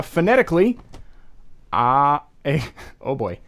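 ordinary talk, nothing marked

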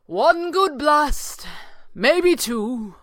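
high, slow, carrying call. A narrator's reading. Sorcerer reckoning his remaining power, calling out. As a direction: call it back deliberately. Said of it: "the sorcerer called".

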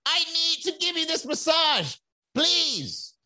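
very high pleading voice; begging masseur. Retold or imitated. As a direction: beg them to take the massage, squeaky and high. Mimicking kiosk masseur begging.

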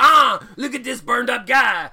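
loud, high, drawn-out cartoon French accent; hammed-up French Canadian nurse exclaiming; mock French Canadian. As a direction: overblown French-accent outburst, slow and loud. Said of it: "that was more Pepe Le Pew".

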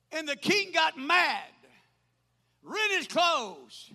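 high, ringing pulpit storytelling; dramatic preaching. Real, not acted. Preacher telling of the maid and the king, dramatic preaching.

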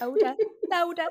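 put-on high childlike repeat; fond toddler echo. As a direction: coo it back like the little girl. Echoing toddler's call.

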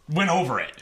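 ordinary talk, nothing marked

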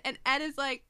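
ordinary delivery

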